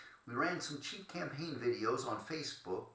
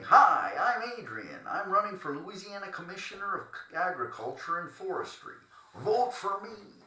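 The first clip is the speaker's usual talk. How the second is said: loud, higher, hammed-up candidate handshake spiel; pushy campaign pitch; 7 semitones higher, 8 dB louder than usual. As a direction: sell yourself hard to every hand you shake. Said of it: "I got aggressive".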